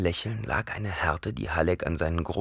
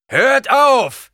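much higher, much louder shout; bellowing; 12 dB louder than usual. Acted. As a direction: bellow across the battlefield. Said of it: "brüllte Halleck". (lautes Brüllen)

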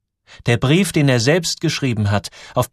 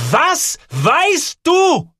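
very high, stretched-out shout; loud, piercing confrontation; roaring demand; 7 dB louder, much slower than usual. A narrator's reading. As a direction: bellow each word separately, very slowly. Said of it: "sie brüllt fast".

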